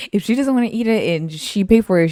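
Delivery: ordinary delivery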